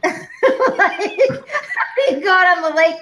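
talking through giggles; laughing. Real, not acted. Telling friends a worry while giggling. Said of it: "laughing while telling".

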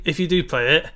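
ordinary talk, nothing marked

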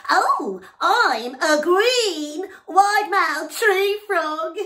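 high, loud, slow booming boast; loud frog. Acted. Noisy frog loudly introducing himself to the elephant. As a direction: proudly bellow the name.

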